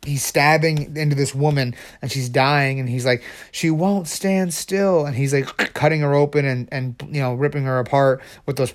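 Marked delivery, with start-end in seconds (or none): 3.5-5.0 drawn-out, higher villain voice; deranged surgeon. Acted. Mad surgeon over his dying patient, deranged and drawn out. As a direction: stretch it out in higher-pitched exasperation.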